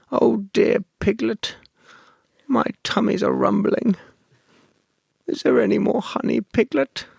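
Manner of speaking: a sweet, hungry Winnie the Pooh; Pooh Bear voice. Acted. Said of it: "That's very good".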